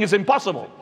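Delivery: ordinary talk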